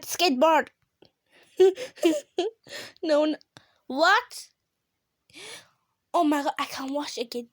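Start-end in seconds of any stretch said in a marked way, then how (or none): none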